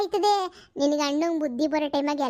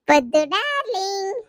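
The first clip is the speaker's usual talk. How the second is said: drawn-out darling; 4 semitones higher, much slower than usual. Acted. Fond call to Paddu.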